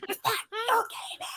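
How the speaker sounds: soft, hushed, trying not to be noticed; hushed discreet aside. Retold or imitated. Asking her friend at dinner, in a hushed voice. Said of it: "when I'm like this".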